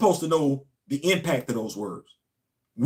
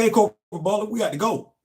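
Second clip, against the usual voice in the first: raised call to a colleague; calling across the room to a busy corporal; 6 semitones higher than usual. Retold or imitated. Coworker calling out to him.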